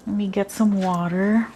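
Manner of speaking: ordinary delivery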